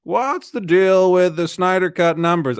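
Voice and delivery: high, drawn-out comic impression; slow, exaggerated stand-up setup; Jerry Seinfeld. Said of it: "I love doing that voice".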